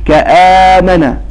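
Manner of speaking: كآمن with the long vowel stretched slowly; drawn-out madd. Shown as a demonstration. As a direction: stretch the madd of كآمن in a slow Quranic chant. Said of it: "فقال".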